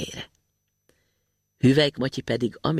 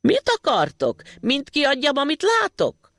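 shouting again; 12 semitones higher than usual. A narrator's reading. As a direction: holler it again as if not understanding. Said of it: "megint rákezdte".

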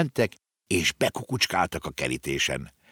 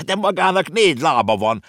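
put-on high, louder boy's retort; objecting; 11 semitones higher, 5 dB louder than usual. A narrator's reading. Konrád arguing with the horse, high boy voice. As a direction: protest like a child. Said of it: "ellenkezett Konrád".

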